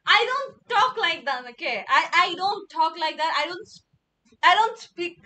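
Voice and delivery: loud, emphatic denial; indignant protest. Real, not acted. Streamer objecting to how they are said to talk, indignant. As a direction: protest loudly, tripping over the repeats.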